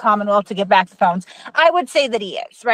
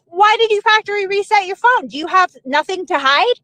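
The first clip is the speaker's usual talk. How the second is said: higher-pitched insistent challenge; re-enacted hallway challenge, voice raised in pitch; retold confrontation; 6 semitones higher than usual. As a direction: repeat the question pointedly, pitched up. Repeats challenge after being brushed off.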